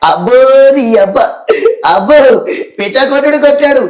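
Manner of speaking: loud hammed-up comic wonder; Sudhakar impression. Shown as a demonstration. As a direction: play it like the old comedian Sudhakar. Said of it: "పాత Sudhakar, comedian".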